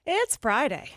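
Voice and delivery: big hyped announcer voice; radio contest promo. Acted. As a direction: pump it up like a promo.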